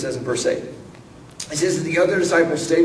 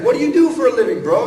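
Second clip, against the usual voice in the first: mocking question; 8 semitones higher, 7 dB louder, faster than usual. Disciples mock the stranger.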